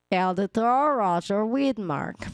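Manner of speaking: ordinary talk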